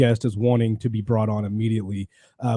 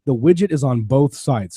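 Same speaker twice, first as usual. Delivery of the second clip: ordinary talk, nothing marked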